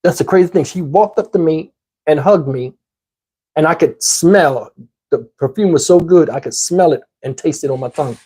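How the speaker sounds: usual speaking voice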